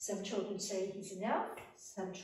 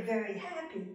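ordinary delivery, nothing marked

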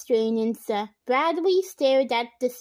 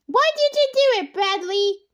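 demanding; 8 semitones higher, 5 dB louder than usual. A narrator's reading. Nancy demanding an answer.

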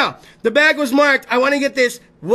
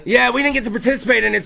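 ordinary talk

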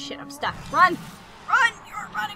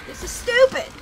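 frustrated whine; 10 semitones higher, 4 dB louder than usual. Real, not acted. Frustrated outburst.

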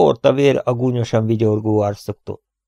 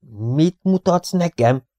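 higher, slow amazed question; astonished; 8 semitones higher, slower than usual. A narrator's reading. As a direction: wonder aloud, slowly. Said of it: "kérdezte csodálkozva Rover". (csodálkozva, lassan)

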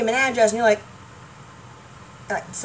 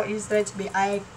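ordinary delivery

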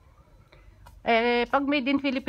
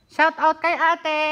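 high, loud, slow announcing shout; 7 semitones higher, 7 dB louder, slower than usual. Real, not acted. Vlogger giving a coworker a loud shout-out, slow and high. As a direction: call it out like an emcee.

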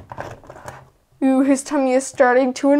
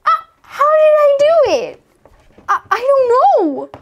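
high, loud squeal of amazement; baffled at the luck, voice shooting up; amazed disbelief; 11 semitones higher, 5 dB louder than usual. Real, not acted. Another impossible lucky roll.

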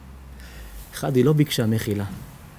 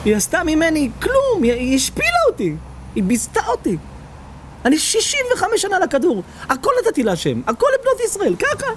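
wailing and crying; 14 semitones higher than usual. Acted. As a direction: cry out each grievance in a high wail. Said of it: "בוכה, מייללת".

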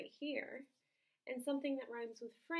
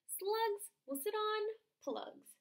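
frog voice; 10 semitones higher, 6 dB louder, much slower than usual. Acted. Frog answers the dog.

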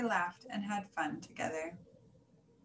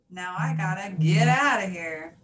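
dismissive send-off; 10 dB louder than usual. A narrator's reading. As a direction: brush them off loudly, with relish. Charlie snubs the rabbits.

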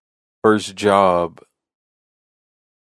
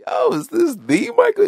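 thrilled, squeaking up high and louder; excited; 5 dB louder than usual. Retold or imitated. Caller thinks he is talking to [name], excited. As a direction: starstruck disbelief. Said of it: "hear the excitement in his voice".

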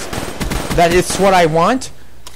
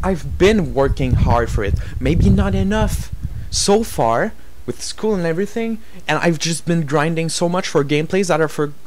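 quiet, lower, deflated voice; dejected; 4 semitones lower, 5 dB quieter than usual. Real, not acted. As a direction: let the anger drain into disappointment. Calms down after the rant, dejected.

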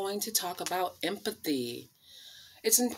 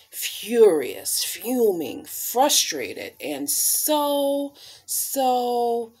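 louder, heated list of angry words; mock anger building; 3 semitones higher, 9 dB louder, much slower than usual.

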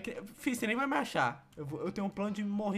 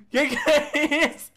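higher and louder, half laughing, half screaming; laughing panicked yell; 6 semitones higher, 9 dB louder, much slower than usual. Real, not acted. Dad laughing and yelling at the baby to get out. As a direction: shriek it out through laughter.

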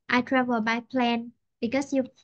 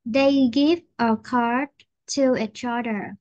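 slow, spaced-out phrases for repeating; slow model sentence; 3 dB louder than usual. Shown as a demonstration. Teacher modelling a sentence for a student, slow. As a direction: say it slowly, phrase by phrase.

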